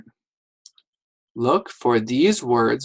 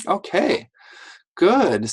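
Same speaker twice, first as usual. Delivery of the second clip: bright praise; 7 semitones higher, slower than usual. Real, not acted. Praise to the class.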